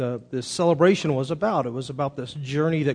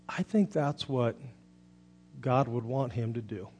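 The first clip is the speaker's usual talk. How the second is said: son's thoughtful answer; 3 semitones lower, 9 dB quieter than usual. Retold or imitated. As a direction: answer gently with long pauses. Son answers his crying father softly.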